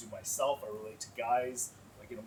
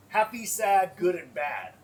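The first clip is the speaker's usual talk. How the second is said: a lightly voiced, higher, louder guy rattling off the words; mock guys answering; 6 semitones higher, 11 dB louder, slower than usual. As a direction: play a guy who knows only four feelings, a bit louder. Guys listing their emotion words.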